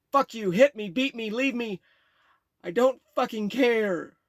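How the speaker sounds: high, loud desperate screaming; screaming child. Retold or imitated. Boy screaming defiance at his mother: loud high scream. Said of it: "cried and screamed".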